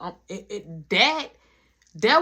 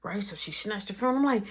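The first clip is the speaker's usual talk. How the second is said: ordinary talk, nothing marked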